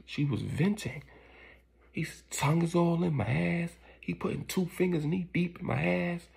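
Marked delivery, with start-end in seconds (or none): none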